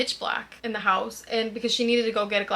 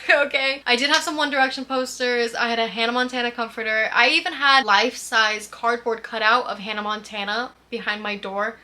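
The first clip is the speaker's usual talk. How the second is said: ordinary delivery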